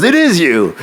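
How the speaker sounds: high-pitched holler of recognition; imitated yell. Friend recognising the honking driver from her stoop, mock holler. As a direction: mimic a friend calling out as she recognises someone. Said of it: "And she'd yell it at me".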